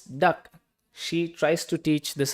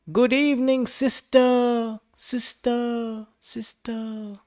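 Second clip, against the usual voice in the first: calling out, fading; 8 semitones higher, much slower than usual. A narrator's reading. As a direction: call into the cave, each fainter. Duck calls into cave.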